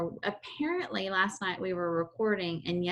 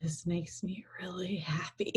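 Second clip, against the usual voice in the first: whisper; 6 dB quieter than usual. Retold or imitated. Teasing imitation of friend's whisper.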